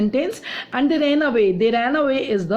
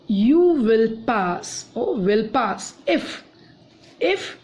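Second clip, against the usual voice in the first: You will pass drawn out very slowly, if set apart by pauses; teacher pulling the example apart clause by clause; slow teaching repeat; slower than usual. Shown as a demonstration. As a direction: break the sentence up for the class. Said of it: "teacher repeats the example, slowed".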